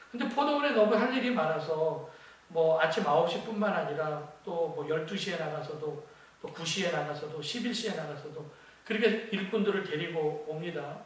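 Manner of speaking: ordinary talk, nothing marked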